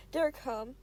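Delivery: ordinary delivery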